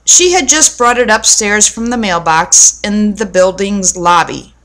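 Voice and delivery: ordinary delivery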